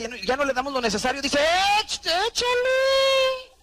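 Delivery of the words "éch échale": very high, very slow, louder stretched demand; hungry monster. Retold or imitated. The starved stomach turned monster demanding food, stretched and shrill. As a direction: growl out a greedy monster's order. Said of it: "Se convierte en un monstruo".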